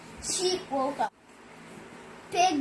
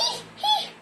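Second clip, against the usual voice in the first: squealing laugh. Acted. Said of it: "and went".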